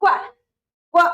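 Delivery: normal talking voice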